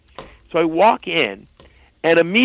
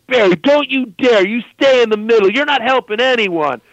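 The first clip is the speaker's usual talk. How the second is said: mock scolding yell; 7 semitones higher than usual. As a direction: warn Barry off, mock furious. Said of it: "Irv's like".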